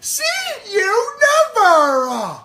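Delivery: very high, loud, emphatic yell; emphatic shout. Retold or imitated. Coach's second see you never, shouted emphatic. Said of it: "Can you do the second one?".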